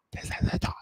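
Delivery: breathy whispered repeat. Shown as a demonstration. Repeating the phrase to viewers, whispered. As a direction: whisper it like a secret.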